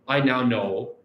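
ordinary talk

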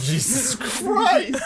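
high, drawn-out laughing exclamation; laughing disbelief. Real, not acted. Friend reacting to a joke gone too far, laughing disbelief. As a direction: crack up in scandalised disbelief, stretching the words.

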